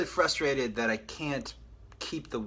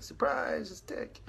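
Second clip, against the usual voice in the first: mock surprise; 12 semitones higher, slower than usual. Mock announcement of an unwanted surprise.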